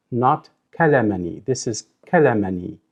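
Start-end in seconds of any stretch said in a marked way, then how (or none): none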